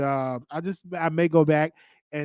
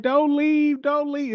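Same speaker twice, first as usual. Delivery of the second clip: put-on high-pitched little-kid plea; mock crying child begging; imitated crying niece; 12 semitones higher, slower than usual. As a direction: plead like a tearful small niece. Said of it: "my niece, she was crying".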